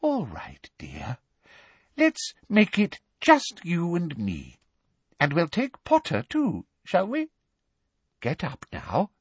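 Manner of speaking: a fake mother's soothing tone; falsely motherly. A narrator's reading. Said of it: "what she clearly thought was a motherly voice".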